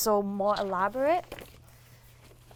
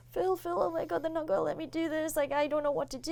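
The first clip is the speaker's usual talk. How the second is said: higher, half-tearful plea, lightly acted; retold weepy panic; 7 semitones higher than usual. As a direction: replay a panicked tearful appeal to a tutor. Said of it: "getting weepy".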